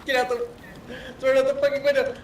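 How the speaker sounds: high, loud panicked yell; panicked shout. Real, not acted. Panicked shouting in chaotic game.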